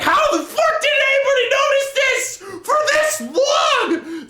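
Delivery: very high, loud, incredulous yell; exasperated outburst. Retold or imitated. Reader fed up with the story, an incredulous yell. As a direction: yell it in disbelief. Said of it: "part of me was like".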